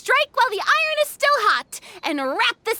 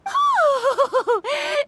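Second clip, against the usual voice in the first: relieved laugh; 7 semitones higher than usual. Acted. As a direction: laugh out the tension. Relief after film found.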